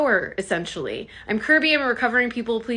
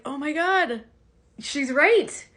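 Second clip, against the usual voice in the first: dawning realization; 6 semitones higher, slower than usual. Retold or imitated. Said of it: "it started dawning on me".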